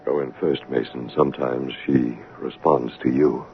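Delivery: quiet order to Mason by the locked door; hushed urging. Acted. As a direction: keep it down so she won't hear. Urging Mason quietly near the madwoman.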